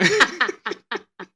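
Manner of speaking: loud burst of laughter. Real, not acted. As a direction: crack up laughing loudly. Botched intro, bursts out laughing.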